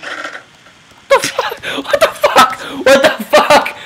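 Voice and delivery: high strained disbelief, half breath; shocked squeal. Real, not acted. Gamer shocked by chaos on screen, high squeal.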